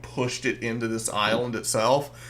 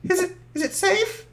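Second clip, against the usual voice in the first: much higher, timid little voice. Acted. GM voicing the hiding little one, tiny timid voice. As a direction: squeak it out like a frightened small creature. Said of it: "He goes".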